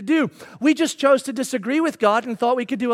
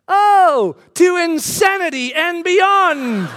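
high, loud, drawn-out heroic cry; imitated child's shout; 5 semitones higher, 4 dB louder, much slower than usual. Five-year-old in the back seat catching on, imitated shout. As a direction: shout it out like a gleeful battle cry. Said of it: "And he shouts".

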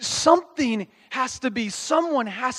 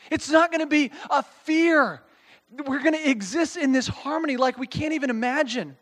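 usual speaking voice

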